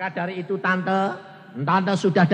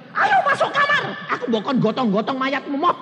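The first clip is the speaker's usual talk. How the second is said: high, loud, laughing urging; hurried wife; 10 semitones higher, 5 dB louder than usual. Acted. Wife rushing husband to the bedroom, high and laughing. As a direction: hurry him inside, high.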